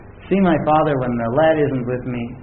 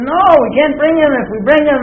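raised high and loud in protest; alarmed objection, pitched high and louder; retold alarmed protest; 9 semitones higher, 5 dB louder than usual. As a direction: push back hard, voice jumping up. Brothers protest to the Egyptian king.

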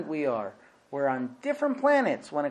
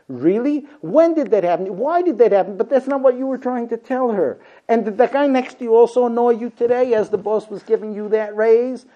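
higher, louder, eager rapid questions; mock eager wife; 7 semitones higher, 8 dB louder than usual. A wife quizzing her husband eagerly about his day. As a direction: pepper him with interested questions. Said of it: "the way women listen".

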